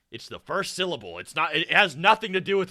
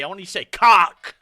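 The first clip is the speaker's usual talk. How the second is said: shouting the word cock loud and high; yelling at Mark to say it; loud demand; 4 semitones higher, 10 dB louder than usual. Pretend. Streamer yelling at the video's narrator, loud mock demand. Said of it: "just say it real loud".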